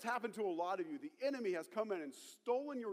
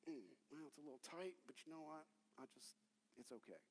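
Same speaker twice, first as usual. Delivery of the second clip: very soft, low, hesitant murmur; meek resignation; 8 semitones lower, 18 dB quieter, faster than usual. Acted. Person grown comfortable in the box, meek resignation. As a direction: talk yourself quietly into settling. Voicing someone comfortable being boxed in.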